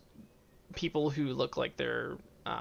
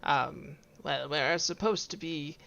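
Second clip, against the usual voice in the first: breezy, smug acquaintance; Clive voice; 3 semitones higher, 4 dB louder than usual. Acted.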